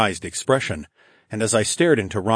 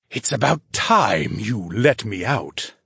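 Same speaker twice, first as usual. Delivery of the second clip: hissed; 2 semitones higher, slower than usual. A narrator's reading. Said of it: "the spirit hissed".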